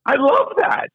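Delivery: high, bright-eyed delight retold; excited praise. Host recalls gushing to the guest, high excited delight. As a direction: gush over the guest's comeback.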